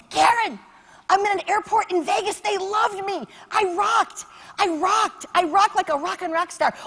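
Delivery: loud, high, thrilled boasting on the phone; excited bragging. Retold or imitated. Friend calling from the airport to brag, excited shout. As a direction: crow about the gig like you just nailed it.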